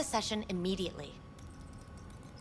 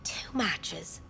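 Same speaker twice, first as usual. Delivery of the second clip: whispered; 3 semitones lower than usual. Acted. Receptionist whispering the match count to the client, hushed. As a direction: murmur it under your breath, intrigued.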